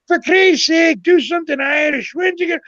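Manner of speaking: high-pitched mock yell; screaming old heckler. Old guy heckling the comic in Montreal, high mock scream. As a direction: imitate an old man yelling from the crowd. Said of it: "some old guy started screaming at me".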